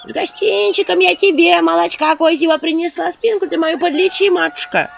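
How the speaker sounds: high, loud, creaky crone plea; an old woman whining about her back; ingratiating old crone; old woman begging. Acted. Crooked old woman begs.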